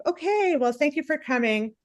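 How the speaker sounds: high-pitched 'okay', imitating a polite waitress; lightly voiced polite server; awkwardly polite waitress. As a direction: play the waitress brushing past an awkward answer. Voices waitress after bad review.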